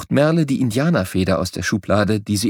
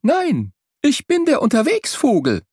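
bird answering; 12 semitones higher than usual. A narrator's reading. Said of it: "antwortet er".

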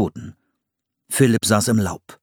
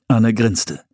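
ordinary delivery

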